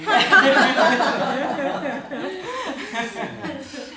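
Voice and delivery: slow then bursting laugh; demo laugh. Shown as a demonstration. Showing the group, full laugh. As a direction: laugh down the breath. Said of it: "just laughing on the way down".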